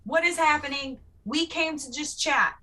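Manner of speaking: high startled whoa; alarmed calming. Acted. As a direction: plead for calm, startled. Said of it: "I'm primarily going".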